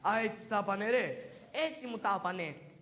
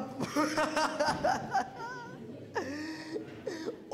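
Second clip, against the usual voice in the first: laughing so hard it turns to crying; helpless laughter; 5 semitones higher than usual. Acted. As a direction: burst out in a long, teary laugh. Karagiozis sees the transformed uncle.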